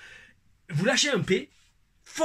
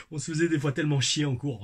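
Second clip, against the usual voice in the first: ordinary talk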